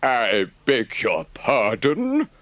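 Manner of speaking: slow, deliberate Rat; slowly. A narrator's reading. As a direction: draw it out, unimpressed. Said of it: "said the Rat slowly".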